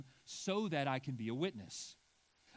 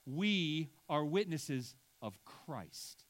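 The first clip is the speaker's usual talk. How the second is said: "of Christ" stressed at the end of a slow louder line; slow, weighty stress on "of Christ"; 3 dB louder, much slower than usual. Shown as a demonstration. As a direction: build slowly to a strong "of Christ". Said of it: "the emphasis in the third point is of Christ".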